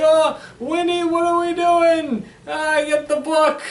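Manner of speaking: hammed-up cackling-witch panic; screaming witches. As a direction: shriek like a panicking witch on a broom. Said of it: "they're flying and screaming".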